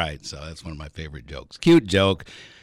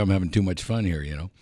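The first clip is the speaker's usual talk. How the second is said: usual speaking voice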